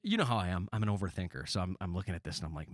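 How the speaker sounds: ordinary talk, nothing marked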